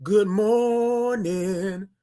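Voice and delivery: very slow, stretched-out GOOD MORNING; slow sing-out morning hello; drawn-out greeting. Shown as a demonstration. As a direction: hold the vowels long, like a call. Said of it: "and you gotta say".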